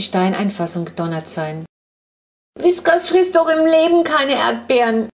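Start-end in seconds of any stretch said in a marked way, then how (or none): 2.6-5.1 put-on high, loud childish whine; a kid's high whimpering protest; whining. A narrator's reading. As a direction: wail it like a little girl clinging to dad. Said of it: "jammert die Kurze".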